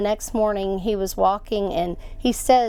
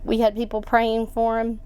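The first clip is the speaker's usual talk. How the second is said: normal talking voice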